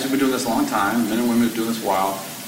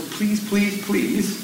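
pleading; 9 semitones higher, much slower than usual. Real, not acted. Pleading with audience to share.

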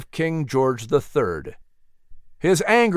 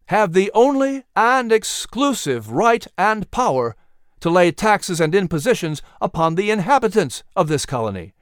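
firm, ringing formal reading; formal proclamation; 5 semitones higher than usual. Acted. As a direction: read out like a solemn decree. Reads resolution aloud.

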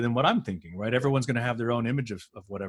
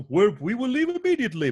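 much higher, stagey hurried promise; mock alien villain; 12 semitones higher, 3 dB louder than usual. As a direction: parody a nervous villain's quick exit. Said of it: "he was like".

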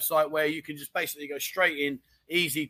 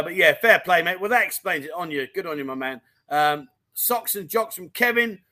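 ordinary delivery

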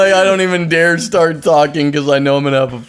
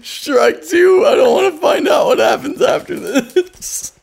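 excited shout; 12 semitones higher than usual. Real, not acted. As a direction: shout it in mock panic. Panicked hiccup joke, shouting.

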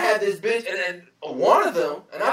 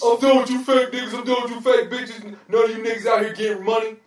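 raised, higher, fast hammed-up tough-guy post; mock dramatic rant; 4 semitones higher than usual. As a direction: puff up like a fake tough poster. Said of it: "I hate when people are on social media like".

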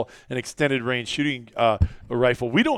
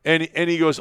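normal talking voice

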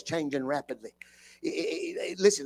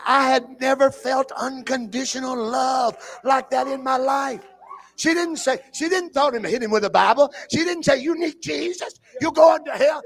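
high, loud shouting climax; preaching shout; 9 semitones higher, 5 dB louder than usual. Real, not acted. Preacher to congregation, crying out the convert's words. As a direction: shout the story's turn to the church.

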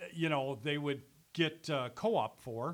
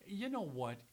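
usual speaking voice

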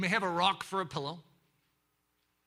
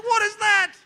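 much higher, louder incredulous cry; astonished; 14 semitones higher, 12 dB louder than usual. Acted. Small man watching giants swing swords, astonished. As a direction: gape at the impossible.

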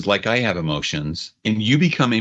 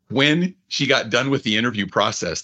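ordinary talk

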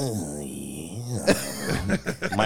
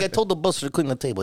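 fast mock Albanian accent; imitating a boss; 6 semitones higher, faster than usual. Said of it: "he's like".

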